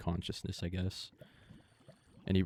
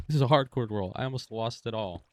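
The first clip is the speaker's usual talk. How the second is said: shaken loud exclaim; 4 semitones higher, 8 dB louder than usual. Real, not acted. Shaken after near death.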